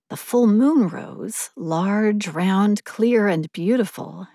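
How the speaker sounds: plain reading voice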